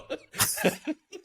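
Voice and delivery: high squealing laugh; real high-pitched laugh; high burst of laughter. Real, not acted. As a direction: crack up at the punchline. Laughs after punchline.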